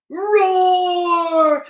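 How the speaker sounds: Yeti roar. Acted. Yeti startles Bear, loud long roar. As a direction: let out a long beast roar.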